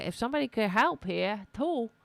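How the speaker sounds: a touch of New York accent, frustrated; lightly New York-flavoured complaint. Real, not acted. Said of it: "Why am I talking like this?".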